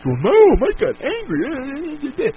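high, drawn-out whiny mock voice; a slow, high, whiny imitation of critics. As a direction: sneer it slowly like a smug critic. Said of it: "There's your fucking rant, people that".

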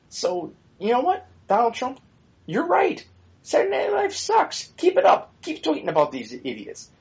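sing-song sarcasm, as if cheering him on; sarcastic mock praise. Mocking Donald Trump with high sarcastic agreement.

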